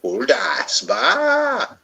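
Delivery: put-on Welsh accent with a sheep bleat. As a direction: end on a high sheep-like baa. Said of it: "Didn't you get my Welsh accent?".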